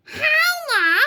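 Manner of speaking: high, loud witchy yell; bawled. A narrator's reading. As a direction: bawl the pupil's name. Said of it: "bawled Miss Spell".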